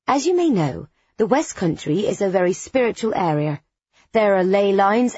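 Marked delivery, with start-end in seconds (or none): none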